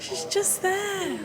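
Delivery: high, slow, choked-up squeak; emotional squeak. Real, not acted. Owner seeing her car again, high and slow. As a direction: let it come out high and wobbly. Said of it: "I'm really emotional about my car".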